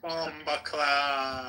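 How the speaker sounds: slow, high drawn-out cry; echoed mock yell. Friend echoing the Jamaican gamer, drawn-out mock yell. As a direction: echo the yell, stretched and high.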